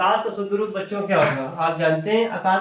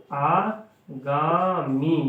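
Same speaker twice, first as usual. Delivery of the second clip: आगामी drawn out very slowly with a rising end; slow dictation; much slower than usual. Shown as a demonstration. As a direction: stretch आगामी for the class to copy. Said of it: "आगामी का क्या होगा?".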